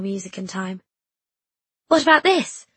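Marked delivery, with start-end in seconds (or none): 1.9-2.7 higher, louder call. A narrator's reading. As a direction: call out across the room. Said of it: "I called".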